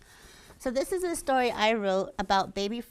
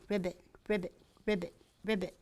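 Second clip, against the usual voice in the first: frog crying; 5 dB quieter, much faster than usual. A narrator's reading. Baby tree frog crying constantly at her birth, soft croaks. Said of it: "would constantly cry".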